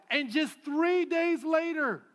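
high, loud and slow peak; full-force preaching. Acted. Pastor hitting the story's turn, loud and slow. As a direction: land the turn hard and slow.

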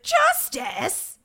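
high, loud shouted imitation; mock yelling. Co-host imitating Craig on the phone, yelling. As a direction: bellow the one word in disbelief, hammed-up. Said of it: "'Cause he has to be yelling".

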